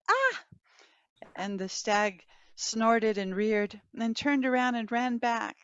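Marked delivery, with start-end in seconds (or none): 0.1-0.4 sudden high, loud yelp of fright; startled cry. A narrator's reading. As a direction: jump back with a sharp cry. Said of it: "startled, went".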